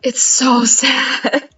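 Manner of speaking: mock-mournful through giggles; laughing sadly. Real, not acted. About the sad book ending, laughing sadly. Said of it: "I'm laughing because it's funny".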